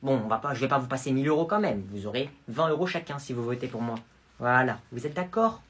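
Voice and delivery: ordinary delivery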